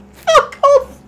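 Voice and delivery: shrieking with tearful laughter, high and loud; hysterical laughing. Real, not acted. Streamer cracking up at a rude driver name, shrieking laughter. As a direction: laugh till you cry, squeaking out the words. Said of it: "I've already got tears in my".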